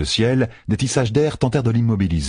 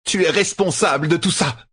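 high-pitched angry accusation; accusing outcry; 10 semitones higher than usual. A narrator's reading. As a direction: cry out the blame at your enemy. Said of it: "s'écria-t-elle". (cri d'accusation, voix aiguë)

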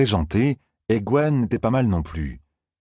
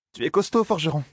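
higher, teasing complaint; Zarine, wry young woman; 10 semitones higher than usual. Acted. Zarine to Perrine after being hoisted, higher and wry. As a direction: tease him for his grip. Zarine rubbing her arm.

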